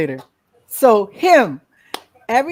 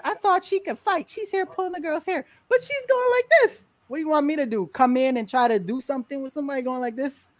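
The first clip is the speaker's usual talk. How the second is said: mocking onlookers; 7 semitones higher than usual. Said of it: "I remember people going".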